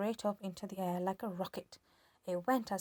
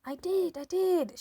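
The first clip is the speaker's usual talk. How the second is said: shouting; 10 semitones higher, 8 dB louder than usual. A narrator's reading. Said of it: "shouted George".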